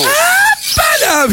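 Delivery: real excited whoop and laugh; delighted outburst. Real, not acted. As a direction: burst out laughing with joy at the verse. Delighted reaction to verse.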